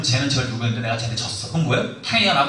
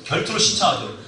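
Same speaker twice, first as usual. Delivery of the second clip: ordinary talk, nothing marked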